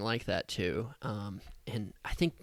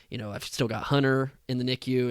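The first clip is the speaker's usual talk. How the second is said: normal talking voice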